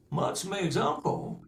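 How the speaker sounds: plain reading voice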